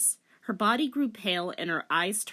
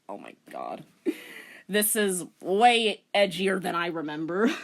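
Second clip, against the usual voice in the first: ordinary delivery, nothing marked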